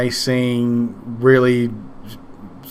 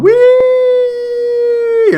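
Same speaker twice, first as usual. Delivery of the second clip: much higher, loud joyful squeal; kid squealing wee; 6 dB louder than usual. Retold or imitated. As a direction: squeal with delight as you fall. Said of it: "hear him in the background going".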